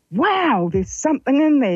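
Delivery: high-pitched wondering exclamation; retold amazement. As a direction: recall a moment of astonished discovery. Retelling her astonishment at an inner voice.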